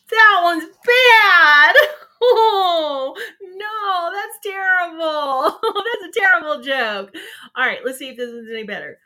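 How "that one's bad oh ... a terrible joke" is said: real helpless laughter, high and loud; laughing at a bad joke. Real, not acted. Host cracking up at the bad pun she just read, laughing hard. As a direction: crack up mid-sentence, almost crying with laughter.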